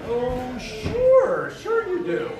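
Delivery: very high, sing-song disbelief; swooping mock-agreeable mother voice; humouring mother. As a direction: humour him with exaggerated agreement. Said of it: "My mother's like".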